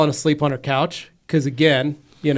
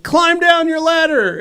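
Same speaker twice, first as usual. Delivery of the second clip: urgent instruction; 13 semitones higher, 4 dB louder, slower than usual. Retold or imitated. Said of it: "I was like".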